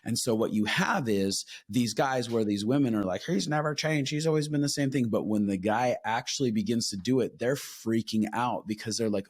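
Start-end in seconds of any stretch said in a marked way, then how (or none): 3.3-5.1 imitating a wife's grievance, higher pitch; imitated fed-up wife; mock complaining. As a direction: complain in a high, steady voice. Voices complaining wives in a retelling.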